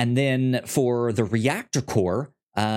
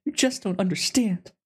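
put-on high, put-upon teenage whine; chiming in as the bard, a high sulky whine at Dad; sulky teen retort; 11 semitones higher than usual. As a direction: toss it off like a misunderstood kid. Joins the bard's whine to Dad.

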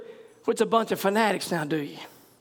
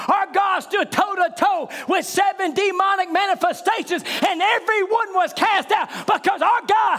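fiery preaching; 8 semitones higher than usual. Acted. Preacher rousing the church at full force.

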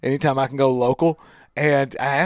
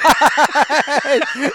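burst of laughter; 9 semitones higher, 5 dB louder than usual. Real, not acted. Laughs at Undertaker joke.

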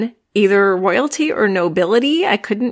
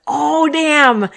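higher, louder "oh, damn"; thrilled delight; 8 semitones higher, 3 dB louder, slower than usual. Retold or imitated. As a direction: gush like the best twist ever. Cop car shows up, thrilled.

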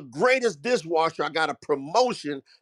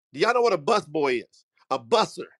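usual speaking voice

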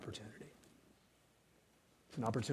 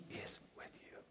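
whisper; 19 dB quieter than usual. Real, not acted. Climax of Psalm 23.

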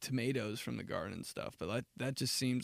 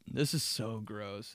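ordinary talk, nothing marked

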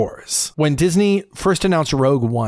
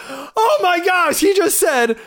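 higher, slow gasp of awe; delighted disbelief; 14 semitones higher, slower than usual. Retold or imitated. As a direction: gush like a starstruck fan, pitched up. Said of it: "you're just like".

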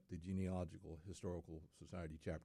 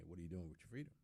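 quick, softer rhetorical question; 7 dB quieter, much faster than usual. Real, not acted. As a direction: toss the question out rapidly. Rhetorical question put twice.

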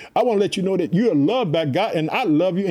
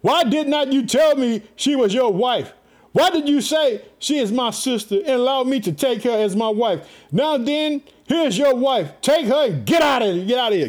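higher-pitched, emphatic accusing read; sharp accusation; 5 semitones higher than usual. A narrator's reading. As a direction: declaim it as an angry ruler's dismissal. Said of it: "and accused him sharply".

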